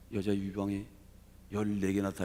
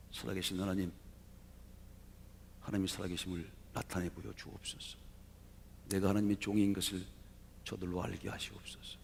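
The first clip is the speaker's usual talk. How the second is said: quiet prayer; 6 dB quieter, faster than usual. Acted. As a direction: pray softly, as one man alone before God. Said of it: "조용히 기도하기 시작합니다".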